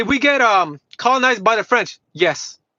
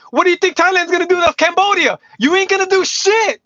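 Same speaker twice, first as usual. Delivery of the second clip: heated outburst; 6 semitones higher than usual. Real, not acted. Heated rant at a viewer.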